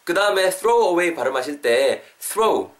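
normal talking voice

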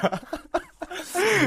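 loud, high laugh; high, helpless cackle; burst of laughter. Real, not acted. Joking about squid smell.

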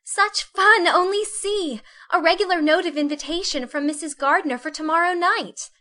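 put-on high, delighted girlish cry; excited Meg. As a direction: squeal the good news. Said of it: "cried Meg".

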